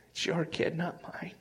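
soft unvoiced whisper; hushed prayer of release; whispered surrender. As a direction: let it go in a whisper.

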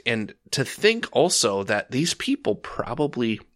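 normal talking voice

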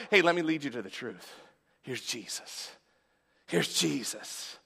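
preacher voicing the Spirit as a soft, tender guide; gentle invitation. Holy Spirit voiced gently offering Jesus.

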